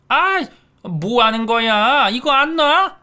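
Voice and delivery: high, loud startled shriek; shrieking in alarm. Acted. Mother-in-law shrieking as her hair is grabbed. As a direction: shriek as your hair is yanked.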